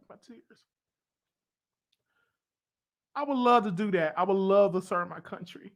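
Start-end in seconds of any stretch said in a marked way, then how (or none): 0.1-0.6 unvoiced, tearful whisper. Real, not acted. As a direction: breathe it out, near tears. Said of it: "I just got emotional".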